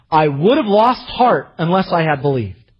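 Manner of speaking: proclaimed scripture. Acted. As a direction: proclaim it to the congregation. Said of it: "I love the older translation".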